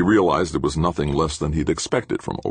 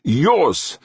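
high, loud accusing word; firm repetition; 14 semitones higher, 5 dB louder than usual. A narrator's reading. Professor returning blame to his engineer, firmly accusing. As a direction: throw the blame straight back. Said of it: "Ulrich repeated".

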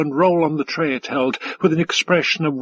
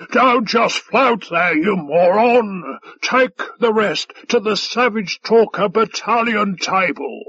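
shouting; 5 semitones higher than usual. A narrator's reading. Five Tide ordering the waiter off, shouting. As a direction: berate the servant in a raised shout. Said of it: "he shouted".

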